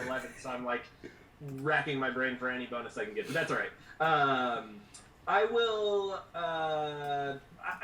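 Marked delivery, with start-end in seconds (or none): none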